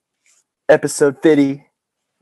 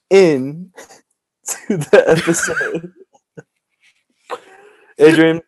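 slow, laughing through words; 4 semitones higher, slower than usual. Real, not acted. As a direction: laugh while saying it. Laughing at own joke.

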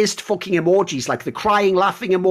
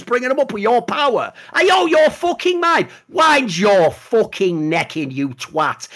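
heated outraged rant; 8 semitones higher than usual. Real, not acted. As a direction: tear into him in disbelief.

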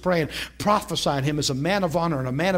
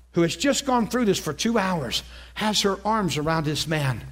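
ordinary talk

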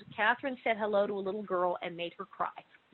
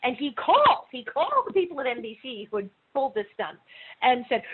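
ordinary delivery

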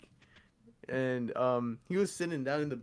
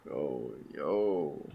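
creepy flirt; 9 semitones lower, much slower than usual. Retold or imitated. Classmate leering at him at the lunch table, low slow sleaze. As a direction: ooze it out like a creep trying to seduce.

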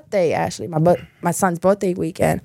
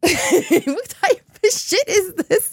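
laughing disbelief; 10 semitones higher, much slower than usual. Real, not acted. Laughing at ex's mood swings.

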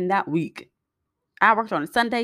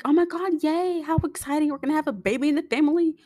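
happy, chipper; 9 semitones higher than usual. Retold or imitated. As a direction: sound thrilled about the new baby. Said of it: "Like, all happy, happy, chipper".

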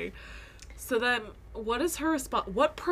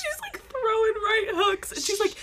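laughing; 11 semitones higher, 3 dB louder than usual. Real, not acted. Retelling the fight, cracking up.

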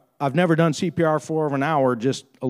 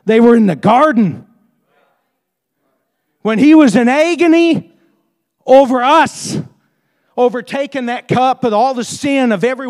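raised, higher-pitched sermon voice; impassioned preaching; 6 semitones higher, 7 dB louder than usual. Real, not acted. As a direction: preach it with force, building to louder peaks.

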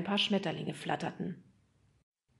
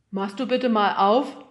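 louder, slow grumbled request; grumbling father; 3 semitones higher, 9 dB louder than usual. A narrator's reading. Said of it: "brummte Nemos Vater".

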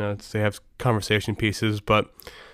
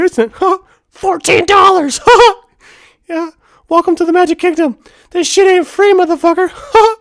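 very high, squeaky cartoon mouse voice, much louder; Mickey Mouse voice; 9 dB louder than usual. Husband annoying his wife at Disney World in a squeaky, high cartoon mouse voice. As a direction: squeak it out like a theme-park mascot hustling tourists, with giggly huhs. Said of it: "the Mickey Mouse voice literally the entire trip".

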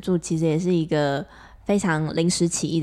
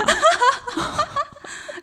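high laugh. Real, not acted. Laugh after joke.

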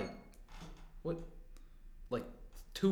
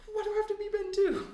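much higher pitched complaint; whiny protest. Acted. Double objects to being numbered second, high-pitched protest.

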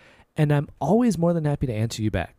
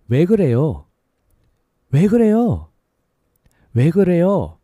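quicker and louder, drama-style; a little faster, exasperated; 3 semitones higher, 6 dB louder, much faster than usual. Shown as a demonstration. Teacher repeating at natural speed, exasperated. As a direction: toss it off like an annoyed question. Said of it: "A little faster".

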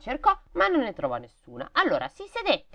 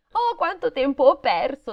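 put-on higher, louder little-girl lament; girl's voice; 8 semitones higher, 5 dB louder than usual. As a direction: voice the girl, distressed. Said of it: "disse la bambina". (voce da bambina, più acuta)

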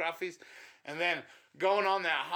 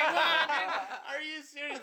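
much higher, laughing mock outrage; mock disbelief; 11 semitones higher, slower than usual. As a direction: act shocked at the accusation. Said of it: "And I'm like".

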